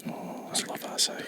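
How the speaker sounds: unvoiced, breathy whisper; whispered aside. Real, not acted. Friend hearing about acai bowls, whispering an aside. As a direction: whisper it to yourself. Listener reacting.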